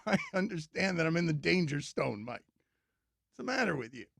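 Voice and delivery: usual speaking voice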